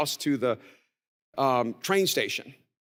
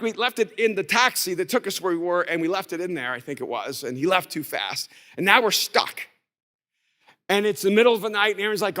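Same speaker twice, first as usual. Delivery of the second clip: ordinary talk, nothing marked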